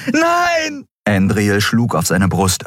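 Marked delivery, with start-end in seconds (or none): 0.1-0.9 very high, long cry of refusal; desperate scream. A narrator's reading. Andriel fights against Kero's hold, screaming. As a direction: scream in protest while struggling.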